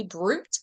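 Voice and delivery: "brute" said as it is spelled, BROOT; spelling pronunciation. Shown as a demonstration. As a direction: read "brute" out with its final T. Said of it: "It's spelled brute".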